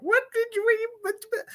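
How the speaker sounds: high, puzzled "what, what?"; confused bystander. Retold or imitated. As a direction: squeak it out like someone lost. People confused by her mumbling.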